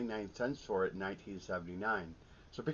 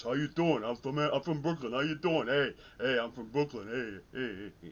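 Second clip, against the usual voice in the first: louder, higher put-on Brooklyn tough-guy accent; 3 semitones higher, 4 dB louder than usual. Imitating a college friend from Brooklyn for viewers, in a Brooklyn accent. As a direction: ham up a New York Brooklyn accent, drawing out the second I'm from Brooklyn. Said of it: "talk like this".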